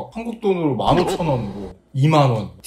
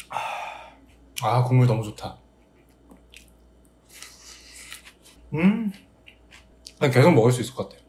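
chewing between words; talking while eating; faster than usual. Real, not acted. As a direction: mouth partly full. Seen 1: Sips soup just before, then eats noodles between the phrases.

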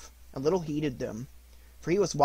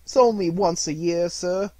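pleading; 4 semitones higher, 7 dB louder than usual. A narrator's reading. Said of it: "pleaded Bob".

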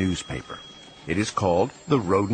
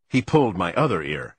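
ordinary delivery

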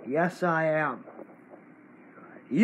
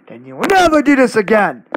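fast, much louder threat; angry threat; 2 semitones higher, 8 dB louder, faster than usual. Real, not acted. Kid warning the fake channel never again, angry. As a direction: bark the warning at him.

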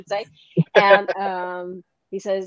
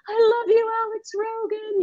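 quoted movie line; 13 semitones higher than usual. Retold or imitated. Actress quoting her own movie line.

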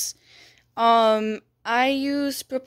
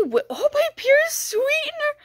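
overwhelmed squeal; 13 semitones higher than usual. Real, not acted. Overwhelmed by new items.

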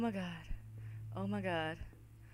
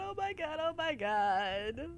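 usual speaking voice

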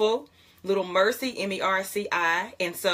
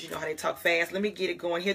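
ordinary talk, nothing marked

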